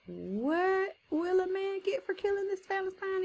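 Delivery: high-pitched incredulous asking; David's eager question. Acted. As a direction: act out a young man astonished by the reward. Acting David questioning the soldiers.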